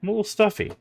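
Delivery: high squeaky take. Shown as a demonstration. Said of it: "if you can't tell from my voice".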